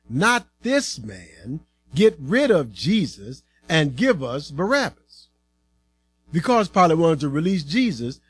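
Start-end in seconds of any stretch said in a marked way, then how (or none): none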